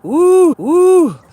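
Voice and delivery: very high, loud hype yell; excited high whoop. Real, not acted. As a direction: let out a wild whoop. Celebratory whoops.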